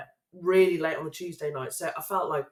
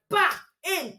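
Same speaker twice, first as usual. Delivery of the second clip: eager shout; 10 semitones higher than usual. Retold or imitated. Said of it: "you were like".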